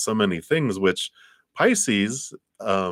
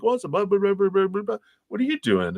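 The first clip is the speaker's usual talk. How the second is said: high, breezy chatter brushing it off; eager reply; 13 semitones higher, faster than usual. Retold or imitated. You tell the stranger about your piece, high and breezy. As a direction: toss off the details in a high playful voice.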